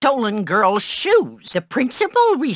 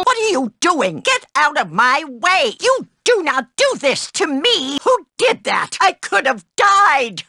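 shocked outrage; 7 semitones higher than usual. Acted.

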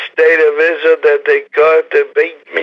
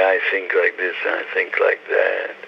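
soft, mild voice with a hint of French accent; understated French accent, softer; gentle French-accented rabbi; 5 semitones lower, 6 dB quieter than usual. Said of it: "his gentle voice, with a slight French accent".

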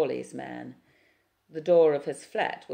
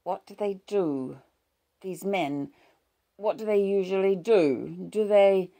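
through clenched teeth; 5 semitones higher, 6 dB louder than usual. A narrator's reading. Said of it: "she speaks through half-clenched teeth".

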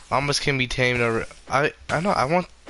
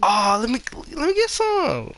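squeaky eager; 13 semitones higher than usual. Real, not acted. Grabbing loot eagerly.

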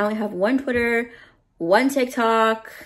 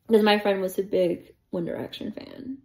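ordinary delivery

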